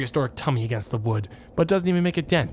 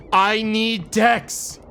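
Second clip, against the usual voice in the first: drawn out; 5 semitones higher, 4 dB louder, much slower than usual. Acted.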